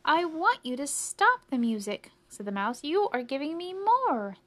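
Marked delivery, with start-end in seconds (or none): 0.0-2.1 higher, louder complaint; annoyed mouse. Acted. Sleepy mouse tells two crickets to stop, annoyed. 2.8-4.4 high, loud protest climbing up; exasperated mouse. Acted. Mouse protests at the second cricket, exasperated.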